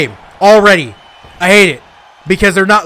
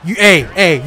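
ordinary delivery

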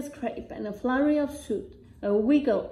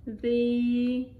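plain reading voice

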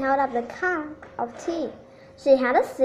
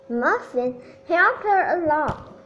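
plain reading voice

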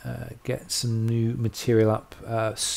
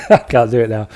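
breaking up with laughter mid-sentence; can't hold it together, grinning through the words; laughing; 8 dB louder than usual. Real, not acted. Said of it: "I'm grinning too much".

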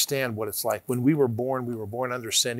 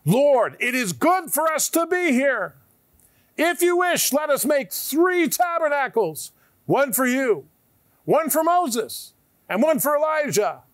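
high, loud excited blurting; eager Peter; 11 semitones higher, 4 dB louder than usual. Acted. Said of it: "Peter answered and said".

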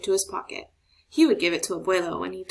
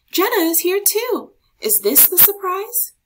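put-on much higher, louder eager kid voice; excited child; 11 semitones higher, 6 dB louder than usual. A narrator's reading. Marco spotting his friend Jenna, high excited call. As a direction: bubble over with excitement.

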